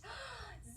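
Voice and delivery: sharp surprised intake of breath; playful gasp. Acted. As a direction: gasp like something exciting is coming. Song gasp before zip.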